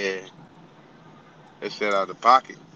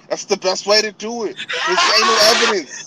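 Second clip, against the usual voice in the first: loud laughing shout; 8 semitones higher, 6 dB louder than usual. Real, not acted. Laughing at the joke.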